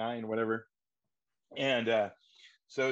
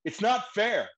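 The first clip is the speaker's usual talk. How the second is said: much higher, loud protesting cry; over-the-top wail; 11 semitones higher, 6 dB louder, slower than usual. Acted. Actor in cramped audition room, loud high wail. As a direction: raise the roof, overplay it. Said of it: "raise the roof".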